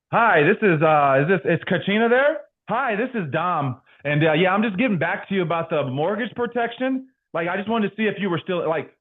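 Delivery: high, over-polite salesman pitch; stiff insurance-agent phone voice. Retold or imitated. Rookie agent phoning a client, stiff high sales voice. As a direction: imitate your old, put-on phone voice. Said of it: "I called them like".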